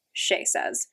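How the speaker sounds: ordinary delivery, nothing marked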